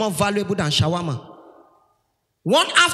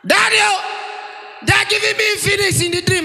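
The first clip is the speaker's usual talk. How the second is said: fast, high-pitched hammed-up shout; sister's shout; 9 semitones higher than usual. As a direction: cry out to daddy in pretend panic. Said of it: "my sister shout".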